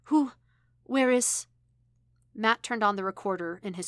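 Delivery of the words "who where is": high, loud startled questions; gasping. A narrator's reading. Android jolted awake as the switch flips, gasping. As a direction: blurt it out, startled and higher. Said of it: "Elektra gasped".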